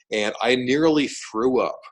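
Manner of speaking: ordinary talk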